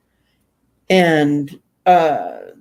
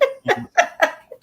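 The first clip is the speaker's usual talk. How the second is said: a high bright little laugh; light laugh; 11 semitones higher than usual. Real, not acted. Laughing after reading comment.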